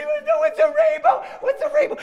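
put-on very high, loud toddler wail; hammed-up sobbing child; mock sobbing. Three-year-old who cannot find it, high hammed-up sobbing. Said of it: "now she's sobbing".

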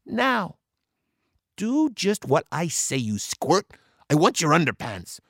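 high-pitched pirate demand; high voice, turning to business; bossy big sister. A narrator's reading. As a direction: order little brother about. Annie orders her brother.

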